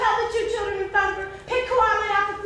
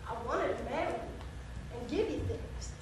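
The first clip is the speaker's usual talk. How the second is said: very soft, low confession; hushed plea; 9 semitones lower, 15 dB quieter than usual. Acted. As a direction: drop to a humble near-murmur. Humble plea to estranged woman.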